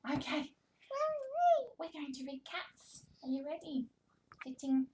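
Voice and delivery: high, animated picture-book voice with big gasps; reading to a toddler. Shown as a demonstration. Speech therapist reading to toddler, animated and sing-song. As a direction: read it like a thrilling show for a baby.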